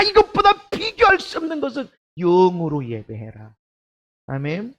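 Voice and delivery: usual speaking voice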